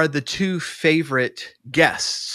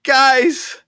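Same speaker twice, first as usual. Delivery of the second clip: high, loud, drawn-out appeal; teasing impression of someone begging for attention; mock plaintive friend; 13 semitones higher, 7 dB louder than usual. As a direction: call out plaintively in a put-on high voice. Imitates friend appealing to the group.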